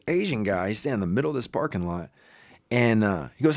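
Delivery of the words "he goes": ordinary talk, nothing marked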